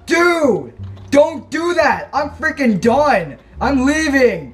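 high, startled cries; panicked yelling. Real, not acted. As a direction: yell in alarm at a sudden ambush. Ambushed by monsters in game.

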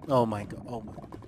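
usual speaking voice

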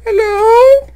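thin ghostly high call; haunt the cave with a ghost voice; eerie falsetto hello; spooky falsetto. Pretend.

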